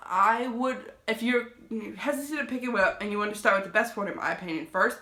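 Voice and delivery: ordinary delivery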